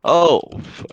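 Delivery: sudden high cry of surprise; startled. Real, not acted. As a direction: caught off guard, blurted out. Sudden death in match.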